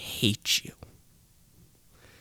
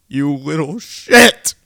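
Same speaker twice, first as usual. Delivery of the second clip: loud, higher angry yell; furious outburst; 6 semitones higher, 11 dB louder than usual. Acted. Cursing Kevin Beck, bursting into a furious yell. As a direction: explode at him. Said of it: "I am seething with rage".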